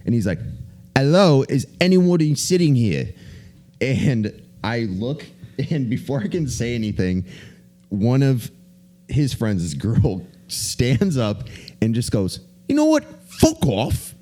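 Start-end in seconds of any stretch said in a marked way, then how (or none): 1.0-3.1 slow, high, louder British voice; mock British bloke asking again, a bit miffed; British accent. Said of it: "pull out your British accent again". 12.7-14.2 very high mock British girl snapping; imitating a British girl telling a bloke off; British accent. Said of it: "pull out your British accent again".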